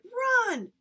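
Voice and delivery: high, loud urgent yell; shouting. Acted. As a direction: yell at him to get away. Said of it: "Christopher John and I shouted".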